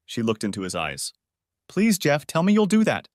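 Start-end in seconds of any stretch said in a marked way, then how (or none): 1.7-3.1 high, pleading plea. Acted. Pleading with Jeff.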